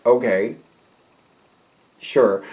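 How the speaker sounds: stunned, halting agreement, long pauses; mildly dazed, faltering reply; deer in headlights. Acted. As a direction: trail into a bewildered yes. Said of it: "just kind of like deer in the headlights".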